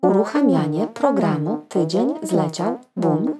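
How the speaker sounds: ordinary talk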